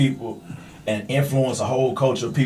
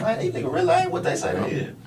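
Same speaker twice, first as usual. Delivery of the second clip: high, thin put-on voice, a lot softer; mock disgusted fan voice; 6 dB quieter than usual. Friend on a podcast mimics a fan's high, disgusted voice. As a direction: squeak it out like a disillusioned listener.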